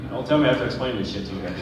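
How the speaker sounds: ordinary talk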